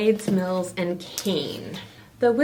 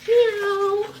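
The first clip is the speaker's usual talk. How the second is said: high cat meow; 12 semitones higher than usual. Acted. Cat voice in story.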